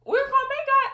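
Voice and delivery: amused, loud burst rising high; laughing. Real, not acted. Explaining to listeners why she's laughing, amused and laughing. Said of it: "I'm laughing because".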